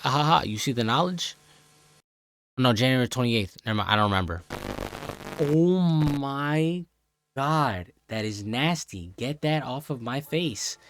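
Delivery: ordinary talk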